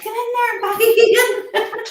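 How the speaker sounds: puffing after wrestling the dog out; out of breath. Real, not acted. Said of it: "I'm out of breath".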